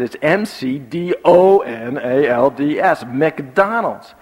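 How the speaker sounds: slow, emphatic letter by letter; parent's insistent spelling lesson; spelled-out correction. Retold or imitated. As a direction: spell it out pointedly, then say the word. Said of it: "I said".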